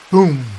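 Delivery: loud booming thunder noise. A narrator's reading. Narrator booms the thunder over the town. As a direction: boom it out like thunder.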